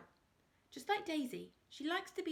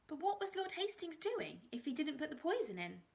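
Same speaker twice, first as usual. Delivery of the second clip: put-on higher, eager girl asking; girl narrator's quoted question; young girl voice; 7 semitones higher than usual. A narrator's reading. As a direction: voice the curious girl, pitched up. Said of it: "I asked".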